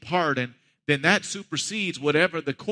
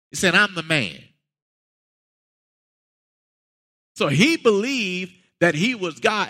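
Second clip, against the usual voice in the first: full-force preaching; 5 semitones higher than usual. Acted. Pastor pressing the congregation on who Jesus is, fervent preaching. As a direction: build it to the rafters.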